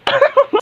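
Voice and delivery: short high-pitched snicker; high giggle. Acted. Classmate amused by bad-breath teasing, giggling. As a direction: giggle at the insult. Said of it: "patawa-tawa ka pa dyan".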